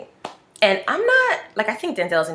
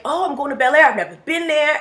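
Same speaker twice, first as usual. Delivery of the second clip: excited; 9 semitones higher than usual. Retold or imitated. Retold excited self.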